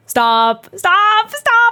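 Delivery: mock-despairing stop, stop, stop; exasperated stop. Real, not acted. Exasperated at the game characters.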